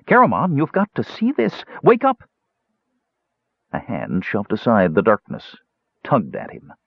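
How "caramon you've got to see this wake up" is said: very high, urgent little voice; eager kender. Acted. Tas rousing sleeping Caramon, high and urgent. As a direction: pipe up high, pressing to rouse him.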